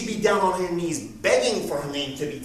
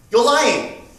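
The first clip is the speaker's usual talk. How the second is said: accusing; 5 semitones higher, 9 dB louder than usual. Retold or imitated. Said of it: "Catherine accusing".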